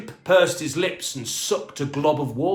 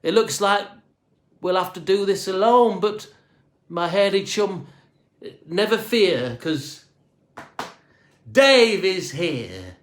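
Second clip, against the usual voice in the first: brave boast; 6 semitones higher, 4 dB louder than usual. A narrator's reading. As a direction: puff yourself up for the chimp. Dave rallying himself.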